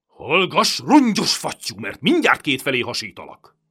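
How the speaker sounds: loud, high furious bellow; roaring threat. A narrator's reading. Turk roaring at the crying children. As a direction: bark him into silence with the dagger drawn. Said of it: "rárivalt".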